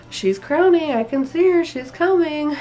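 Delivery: high, loud urgent announcement; excited midwife. Retold or imitated. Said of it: "my midwife goes".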